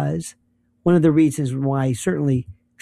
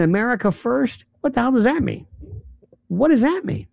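pitched-up incredulous questioning; mock incredulous; 6 semitones higher than usual. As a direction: challenge the slogan as if baffled. Mock puzzled challenge to a slogan.

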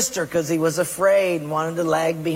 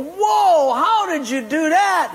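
high, loud astonished whoa; amazed shout; 9 semitones higher, 3 dB louder, slower than usual. Acted. Two friends amazed at the Hawaiian guy's catch, loud high whoa. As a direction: call out in wonder.

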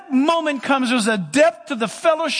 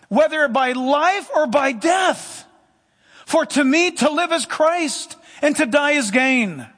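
slow, higher-pitched preaching proclamation; emphatic scripture reading; 4 semitones higher, slower than usual. Acted. Preacher finishing the Philippians verse on life and death, proclaimed slowly. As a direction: declare the verse slowly and forcefully.

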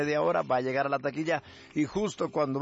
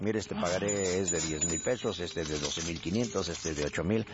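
gallego preocupado; 9 semitones lower, 4 dB quieter than usual. Acted. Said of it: "te noto como que estás muy preocupado". (gallego preocupado, grave y lento)

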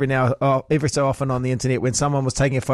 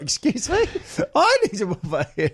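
mock indignant reply; 6 semitones higher than usual. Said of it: "you'd be like".

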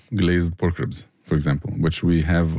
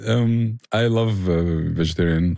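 normal talking voice

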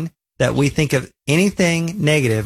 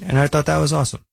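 usual speaking voice

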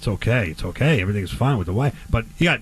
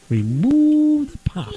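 much higher and very slow, "Remove" lifted up; high comic repeat; 10 semitones higher, much slower than usual. Shown as a demonstration. Host repeats the step for laughs, high and drawn out. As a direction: squeal the words out slow and high. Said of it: "repeat of the instruction in high voice".

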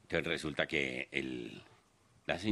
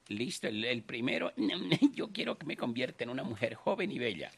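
put-on old lady voice; 8 semitones higher than usual. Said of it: "Entonces llegó y dijo".

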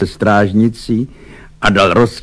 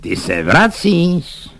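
slow, higher-pitched biting question; venomous sarcasm; 7 semitones higher, much slower than usual. A narrator's reading. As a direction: draw it out with spite. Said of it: "V přízvuku bylo tolik jízlivosti".

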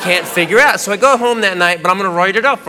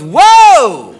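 loud, high drawn-out whoa; startled objection; 4 semitones higher, 8 dB louder than usual. Retold or imitated. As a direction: stop someone with a big startled shout. Retold girlfriend's startled objection.

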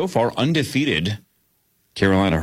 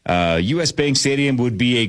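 ordinary talk, nothing marked

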